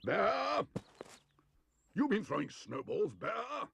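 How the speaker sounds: high, loud angry shout; angry Mister Curry. A narrator's reading. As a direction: bellow at the bear. Angry neighbour shouting at bear.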